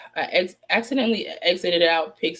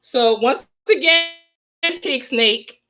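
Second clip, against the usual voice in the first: angry scolding; 6 semitones higher, 7 dB louder than usual. Real, not acted. Host scolding rival Pig Snake, loud and angry. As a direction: come out swinging at a rival.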